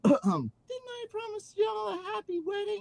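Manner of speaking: put-on very high falsetto, no accent; LaFranc without twang. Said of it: "I forgot the twangy accent".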